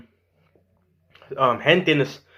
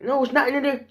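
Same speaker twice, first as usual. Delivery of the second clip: put-on bossy kid voice; 10 semitones higher than usual. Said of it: "So he's like".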